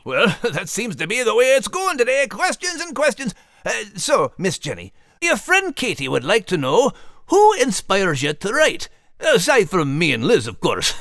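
high Scottish dog voice, playful banter; Max the dog. Acted. Max bantering with Liz then calling Jenny, high Scottish. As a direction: tease in Max's voice.

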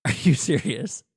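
laughing in disbelief at himself. Real, not acted. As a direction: laugh through the question. Laughs at own stumbling.

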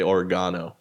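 oregano said or-GAH-no; South African pronunciation. Retold or imitated. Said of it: "She was South African".

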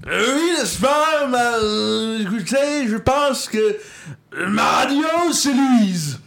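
loud, slow, booming politician voice; Jean-Marie Le Pen. Retold or imitated. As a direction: orate it like an old far-right tribune. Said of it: "avec la voix de Jean-Marie Le Pen".

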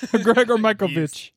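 playful mock Eastern European accent. Co-host introduces himself as last week's winner, joking Eastern European accent. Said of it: "still do an Eastern European accent".

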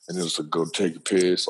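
ordinary talk, nothing marked

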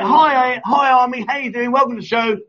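full-energy demo of how to say hello; showing enthusiasm. Shown as a demonstration. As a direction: greet her like a big show host. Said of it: "so I'm like".

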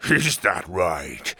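low, slow amused menace; slow mocking villain; chuckling villain. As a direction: draw it out low, smugly amused. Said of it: "Tuga chuckled".

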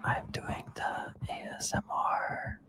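very soft, slow whisper into the mic; ASMR whisper. Pretend. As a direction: parody ASMR, whispered right up close. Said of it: "then you have to whisper".

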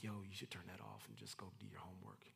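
low, quick near-whisper; inner voice. Acted. Check in your spirit while watching TV, hushed nudge. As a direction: murmur it softly, like a nudge inside. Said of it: "a little check in your spirit like".

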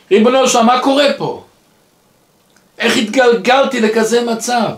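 exasperated questioning prayer; retold desperate plea. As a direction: cry out to heaven, as retold.

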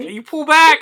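high, loud teasing imitation of a friend; mock stern warning. Teasing friend imitates Mary holding back, mock high voice. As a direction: mimic her warning in a squeaky voice. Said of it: "and you're like".

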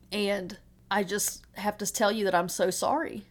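ordinary talk

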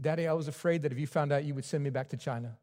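lightly voiced scared son; small child afraid. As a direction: son confessing his fear softly. Son's heartbreaking confession.

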